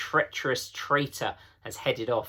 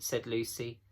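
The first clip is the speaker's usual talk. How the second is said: ordinary delivery, nothing marked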